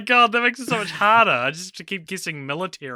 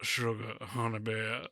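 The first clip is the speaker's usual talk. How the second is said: deep sultry voice; 5 semitones lower, 13 dB quieter than usual. Shown as a demonstration. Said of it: "Like that".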